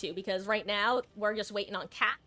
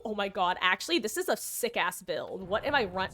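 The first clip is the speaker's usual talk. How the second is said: normal talking voice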